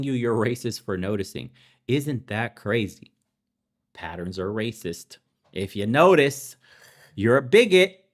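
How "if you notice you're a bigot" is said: mock accusing shout. Mimicking accusers to viewers, a loud mocking shout. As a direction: bark it like a sneering accuser.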